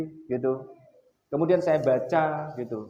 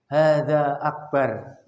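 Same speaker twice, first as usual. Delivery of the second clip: put-on a slow little boy's correction; child's correction; 4 dB louder, much slower than usual. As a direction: mimic the son calling out slowly. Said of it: "Mas Hokma bilang". (tirukan anak kecil mengoreksi pelan)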